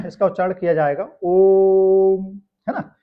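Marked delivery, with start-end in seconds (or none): 1.2-2.4 ओम held long and loud on one level, raised note; sustained, chant-like ओम demonstrating a lengthened vowel; prolonged ओम. Shown as a demonstration. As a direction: stretch the ओ of ओम far beyond normal length, then close on म. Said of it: "उच्चारण अवधि जब बढ़ाई जाती है".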